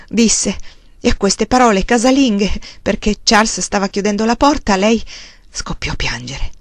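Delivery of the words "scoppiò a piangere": hushed narration. A narrator's reading. Narrator tells of sudden weeping.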